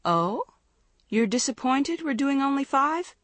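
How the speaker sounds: high, rising feigned disbelief; mock surprise. A narrator's reading. Coach teasing groaning team, mock surprise. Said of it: "an expression of mock surprise".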